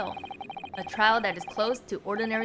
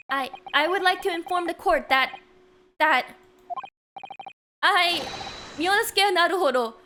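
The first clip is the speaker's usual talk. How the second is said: bold declaration; 7 semitones higher, 5 dB louder than usual. Acted. Voicing protagonist's bold declaration.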